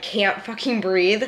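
usual speaking voice

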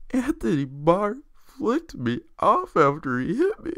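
high, slow crybaby whine; whiny Rodgers impression. Mocking Rodgers on Conan, a high slow whine. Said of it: "he goes".

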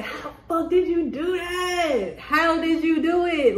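high, slow, laughing disbelief; imitated amazement. Onlookers quoted asking how you did it, mock amazement. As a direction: stretch the question out high, incredulous.

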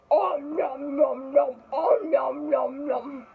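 exaggerated nom-nom chewing noises; pretend munching. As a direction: chomp through it like a cartoon eater.

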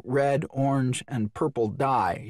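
usual speaking voice

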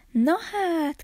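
put-on little boy amazed at the shelves; exclaiming. A narrator's reading. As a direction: burst out in wonder at all the apples. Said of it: "kiáltott fel Kis Miklós".

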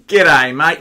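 loud imitated Australian greeting; emphatic Aussie twang, imitated; broad Aussie accent. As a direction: punch out a mimicked Aussie hello. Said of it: "complete with the accent".

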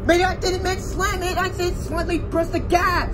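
high, worked-up correction; irritated, high-pitched telling-off; exasperated scolding. Acted. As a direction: snap at the learner driver. Instructor scolds the learner.

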